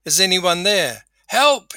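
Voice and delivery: high, loud cry for rescue; yelling for help. Acted. Man hanging from a cliff calls to passers-by, yelling. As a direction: call out desperately to anyone passing. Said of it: "he yelled for a long time".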